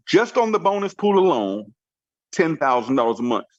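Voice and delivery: ordinary talk, nothing marked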